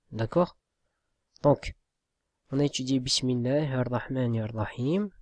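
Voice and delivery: ordinary delivery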